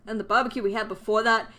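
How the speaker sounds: normal talking voice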